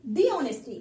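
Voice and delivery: "The" said as long "दी" before a vowel; thee before vowel. Shown as a demonstration. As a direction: say "The" as "दी", then "honesty" with a silent h. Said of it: "इस sentence को आप देखो".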